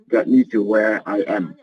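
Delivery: ordinary talk, nothing marked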